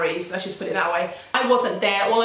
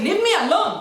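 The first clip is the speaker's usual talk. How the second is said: high, mocking outburst; mimicked Spanish girl; 11 semitones higher, 4 dB louder, faster than usual. The Spanish girl's favourite thing to say, mocking imitation. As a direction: mimic her pet phrase, pitch jumping up. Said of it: "That's her favourite word to say".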